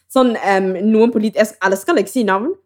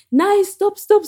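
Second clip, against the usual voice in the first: mom pleading; 10 semitones higher, much slower than usual. Retold or imitated. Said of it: "og mamma bare".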